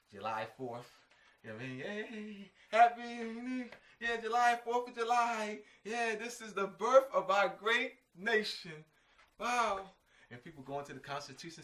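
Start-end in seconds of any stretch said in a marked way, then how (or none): 1.8-9.8 higher, louder cheering put-on voice; lampooning a cheering reveller, higher and louder; mock celebration. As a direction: play a giddy reveller on the holiday. Mocking Europeans cheering July fourth.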